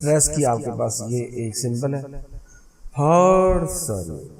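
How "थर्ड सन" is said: Third long and high, सन dropping away; high drawn-out third, falling सन; stress on first word. Shown as a demonstration. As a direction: stretch third, keep सन unstressed. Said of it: "इसे stress दे रहे हैं".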